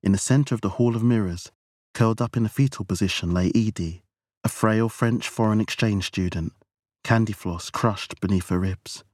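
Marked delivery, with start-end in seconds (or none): none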